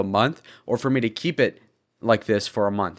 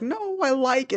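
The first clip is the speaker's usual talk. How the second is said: high mocking imitation of women, much higher; mock girly voice; 3 dB quieter than usual. Imitating women who like his beard, high mock voice. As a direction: squeak it out like a mocked woman. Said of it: "they're all like".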